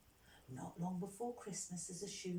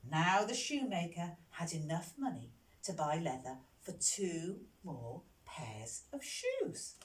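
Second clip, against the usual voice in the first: plain reading voice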